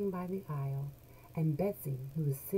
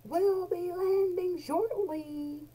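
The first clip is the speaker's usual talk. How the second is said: high, slow, louder pilot announcement; hammed-up captain announcement; captain announcing; 13 semitones higher, 5 dB louder, slower than usual. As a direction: sound like a captain on the intercom. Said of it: "the captain announces".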